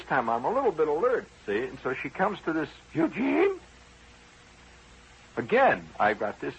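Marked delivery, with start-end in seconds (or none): 2.9-3.6 high, rising prim call of the name; teacher calling roll. Retold or imitated. Teacher calling the name at roll, high and rising.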